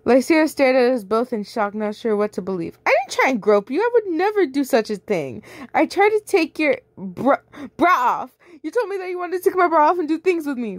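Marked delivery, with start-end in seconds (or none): 2.8-8.4 higher, pleading protest; denying it to everyone, flustered; indignant denial. Acted. Defending against grope claim. 8.6-10.8 much higher, fast sneering voice; rushed accusing girl. Acted.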